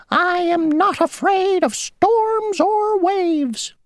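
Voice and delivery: very high, tiny, self-important insect voice; a little insect bragging; boastful mosquito. Acted. Mosquito boasting to Grasshopper mid-puddle, tiny high voice.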